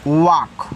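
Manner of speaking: वाक said carefully with a silent L; slow clear वाक, no L sound; model pronunciation. Shown as a demonstration. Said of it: "यानी कि इस तरीके से".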